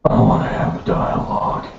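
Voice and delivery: whispering. Pretend. Mock whisper aside.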